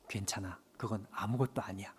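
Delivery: low, hushed and tender reassurance; gentle voice of God. Acted. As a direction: soothe him softly as God would. God's gentle reply, very soft. (낮고 부드럽게 달래는 하나님의 음성)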